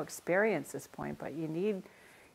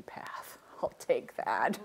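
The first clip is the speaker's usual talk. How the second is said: hushed joke; 6 semitones lower, 3 dB quieter, slower than usual. Real, not acted. Joking aside about easy path.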